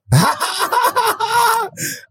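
high-pitched burst of laughter; real laugh. Real, not acted. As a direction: crack up for a long moment. Reacts to the letter.

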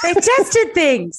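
laughing. Real, not acted. Laughing repeat of host's words.

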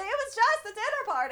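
high, small imitation of protest; hushed high-voiced mock plea. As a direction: plead innocence in a soft high voice. Repeats the protest, much softer.

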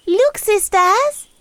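put-on high, slow girlish call; sweet little princess calling out; cartoon princess. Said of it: "she exclaimed".

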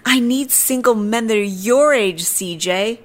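high, insistent mimicry of a stranger; high-pitched pushy woman impression; imitating the woman. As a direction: mimic her demand, pitched well up. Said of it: "And she's like".

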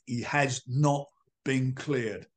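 NOT leaned on, each word slowed right down; deliberate slow insistence on "not"; emphatic denial. Real, not acted. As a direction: spell out "He has not been cleared" slowly and firmly, weight on "not". Insists against the claim he's cleared.

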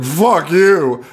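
hammed-up comeback; mock defiance. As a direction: throw it back in playful defiance. Acted defiant comeback in retelling.

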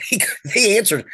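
ordinary talk, nothing marked

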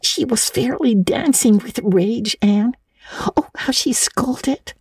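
put-on high girl's voice, partly whispered; hushed, high-pitched schoolgirl; girl Diana. A narrator's reading. Diana tells Anne of the scolding, high and hushed.

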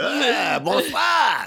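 high, loud hammed-up French hello; mock French greeting. Cohost joins the chicken joke with a hammed-up French hello. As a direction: squeal it like a silly Frenchman.

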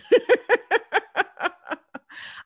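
a bright, high burst of laughter; can't help laughing at the milestone; real laugh. Real, not acted. Host laughing at reaching a hundred, real giggle. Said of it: "I don't know why I'm laughing".

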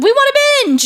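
very high, loud whine-shout; playful outraged yell; excited protest. Real, not acted. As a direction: demand it in a laughing squeal. Mock protest at waiting for more.

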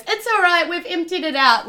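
high bright reassuring voice to the guard; Marley's breezy lie. Acted. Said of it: "Marley just turns around and goes".

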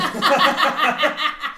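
high loud laughing fit; cracking up loudly; loud laugh burst. Real, not acted. Laughing at sex tape joke.

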